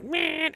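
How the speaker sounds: very high imitated screech; crazy old lady jump scare. Host imitates the crazy old lady appearing, high screech. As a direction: squeal a mock jump-scare noise. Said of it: "it's the little crazy old lady".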